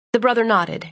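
usual speaking voice

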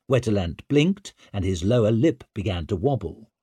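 ordinary delivery

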